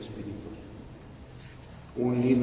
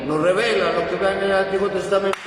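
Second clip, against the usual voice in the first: loud, high, driving sermon voice; fervent preaching; 6 semitones higher, 5 dB louder than usual. Acted. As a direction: preach it at full force to the congregation. Priest preaching with rising force.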